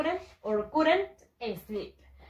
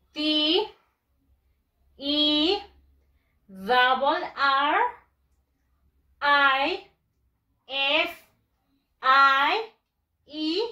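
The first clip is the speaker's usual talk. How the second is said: letters called one by one, higher, with long pauses; drawn-out letters, each on a raised, held note; spelling out; 3 semitones higher, 4 dB louder, much slower than usual. Shown as a demonstration. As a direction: spell it slowly for a class to repeat. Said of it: "Lo vamos a deletrear".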